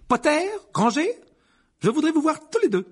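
high, loud summons of a strict professor; calling out sternly. A narrator's reading. Professor summoning two pupils from the crowd, calling out. McGonagall calls pupils across hall.

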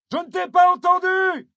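very high, strained shout across distance; yelled. A narrator's reading. Kayaker to girl on the shore, yelled. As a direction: shout it urgently over the lapping waves. Yelling that the answer was lost.

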